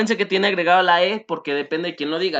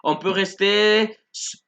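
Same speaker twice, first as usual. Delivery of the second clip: slow, careful French model line; slow model phrase; 4 semitones higher, much slower than usual. Shown as a demonstration. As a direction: spell it out slowly for the class. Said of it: "no sé".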